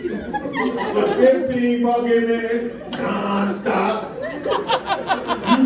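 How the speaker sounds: slow, stretched words; drawn out slowly. Acted. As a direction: drag every word out. Slowed exaggerated drawn-out delivery.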